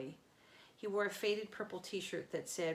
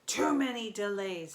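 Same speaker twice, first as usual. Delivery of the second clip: louder monster bellow; 2 semitones higher, 8 dB louder than usual. A narrator's reading. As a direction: roar it as an impatient giant. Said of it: "the monster bellowed".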